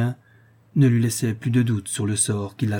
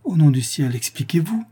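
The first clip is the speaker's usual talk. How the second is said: terrified plea; 5 semitones higher than usual. A narrator's reading.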